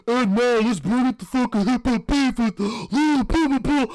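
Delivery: mocking dumb-host voice, high and loud. Podcaster mocking a host hyping hip hop beef, loud caricature. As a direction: imitate a dumbass host hyping trivia. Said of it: "[name]'s dumbass is like".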